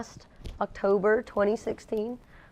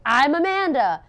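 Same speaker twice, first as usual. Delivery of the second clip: high, loud comic reveal; joking realization; 9 semitones higher, 8 dB louder, slower than usual. Retold or imitated. Walking up to accept, a high loud comic reveal. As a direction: announce it like a punchline. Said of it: "I was like".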